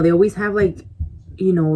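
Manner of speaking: ordinary talk, nothing marked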